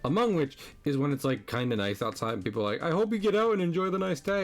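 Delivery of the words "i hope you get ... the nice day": high, sing-song fake cheer; mock cheerful. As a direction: parody a chirpy coworker. Mocking cheerful coworkers.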